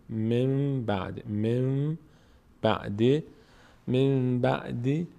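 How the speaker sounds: "من بعد" slow, the N turned into a long M; nun pronounced as mim. Shown as a demonstration. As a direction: chant "من بعد" as "mim ba'd" with a held hum.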